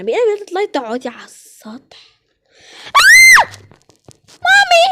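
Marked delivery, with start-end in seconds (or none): 0.5-2.0 whisper. Acted. Aside in disbelief. 2.9-3.5 scream. Acted. Sudden scream at the doll.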